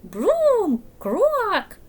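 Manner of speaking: high, loud croaking yell; frog scream. Acted. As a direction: blast it out like a chunky frog. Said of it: "the chunky frog screamed again".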